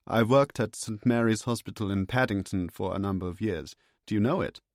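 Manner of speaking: a genial German doctor charmed by his guest; chirpy German accent. Acted.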